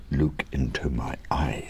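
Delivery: hushed whisper. Acted. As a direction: whisper it close. Whispered aside, unvoiced.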